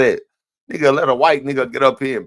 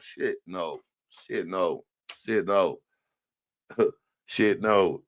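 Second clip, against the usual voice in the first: muttered refusal; 9 semitones lower, 9 dB quieter, much slower than usual. Real, not acted. Dismissive repeated refusal.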